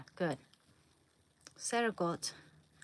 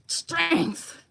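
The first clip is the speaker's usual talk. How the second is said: higher, louder hammed-up flex; mock strongman; 10 semitones higher, 10 dB louder than usual. Straining with magnets, she calls out like a mock strongman. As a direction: boom it out like a cartoon strongman.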